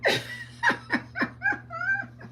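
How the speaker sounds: 高い声でケラケラ笑う; high cackling laugh. Real, not acted. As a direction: burst into a long high cackle. Laughing at viewer's comment.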